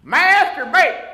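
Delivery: high, loud comic outburst; yelled. Retold or imitated. Waiter yells at a diner ordering potato, comic high yell. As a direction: blurt it out as a mock waiter's yell. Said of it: "He just yells out".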